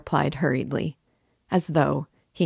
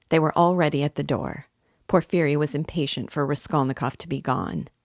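usual speaking voice

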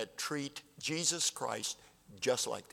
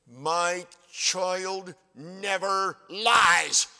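put-on loud, very slow, higher "My child never lies" as a huffy mother; indignant parent; 6 semitones higher, 7 dB louder, much slower than usual. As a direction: drag it out, offended and proud, like a parent defending her child.

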